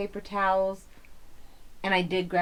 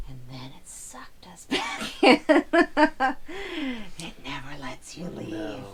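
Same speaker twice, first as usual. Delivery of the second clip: spooky whisper; 3 semitones higher, slower than usual. Acted. Spooky line about the fire.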